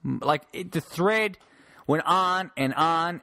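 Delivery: ordinary delivery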